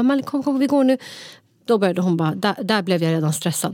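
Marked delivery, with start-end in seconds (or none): 0.0-1.0 high-pitched, urgent coaxing; retold panic. Sister urging little nephew to come along, retold panic. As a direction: act out a panicked plea to a child. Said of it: "helt panik".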